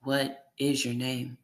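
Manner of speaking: each syllable spelled out slowly; over-enunciated, syllable by syllable. Acted. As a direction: talk slowly as if to someone who can't hear. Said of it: "enunciating each syllable in the way that people talk".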